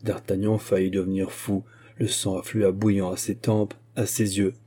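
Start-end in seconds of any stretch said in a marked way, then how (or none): none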